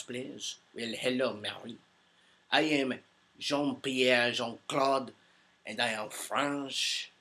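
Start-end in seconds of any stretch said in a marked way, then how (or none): 2.5-7.1 cartoony French accent. Greeting a fan who asked for it, cartoony French accent. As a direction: lay on a cartoon Frenchman's accent, dragging out the names. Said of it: "in the French voice".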